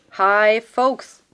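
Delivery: loud, breezy hello to the class; calling out. Acted. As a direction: greet the noisy class loudly and casually. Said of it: "rief Jens Klare".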